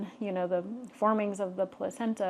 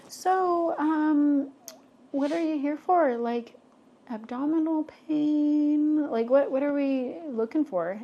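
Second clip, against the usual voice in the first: higher, puzzled quizzing, put-on voice; ultrasound tech's quizzing; 7 semitones higher, 4 dB louder than usual. Technician questions patient about her visit, puzzled higher voice. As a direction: voice a confused technician checking the chart. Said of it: "she's like".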